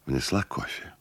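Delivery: ordinary delivery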